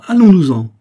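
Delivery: anguished near-scream to flee; anguished outcry. A narrator's reading. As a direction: break out in panic on the repeat. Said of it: "Une angoisse telle entrecoupait sa voix".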